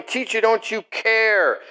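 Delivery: high, stretched cry of protest; acted shouting. Disciples waking their teacher, half-shouted plea. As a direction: call out pleadingly, drawing the words out. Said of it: "They woke up shouting".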